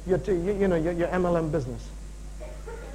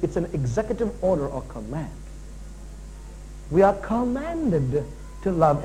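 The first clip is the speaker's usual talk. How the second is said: ordinary delivery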